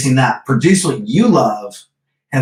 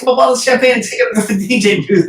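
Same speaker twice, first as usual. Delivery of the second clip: high, quick burst of fan excitement; gushing quote, racing then easing off at the end; excited retold exclamation; 8 semitones higher than usual. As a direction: re-enact an eager, starstruck suggestion, pitched up. Retold eager idea in club.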